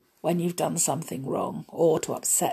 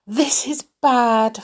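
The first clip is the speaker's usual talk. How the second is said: mildly fretting clock, raised and louder; 5 semitones higher, 5 dB louder than usual. A narrator's reading. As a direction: fret with a touch of worry. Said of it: "fretted Cogsworth the clock".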